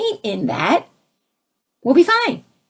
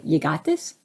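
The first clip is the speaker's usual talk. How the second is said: softer, lower, calm reassurance; 6 semitones lower, 5 dB quieter than usual. Retold or imitated. Speaker showing what she should have said, soft and calm. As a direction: say it gently to yourself. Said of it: "merely say".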